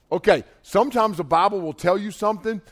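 normal talking voice

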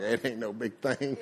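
low, soft mock nonchalance; unfazed mama. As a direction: play the mama who shrugs it off. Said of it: "She was like".

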